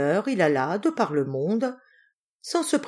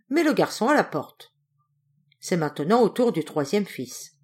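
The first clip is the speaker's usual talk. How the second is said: ordinary delivery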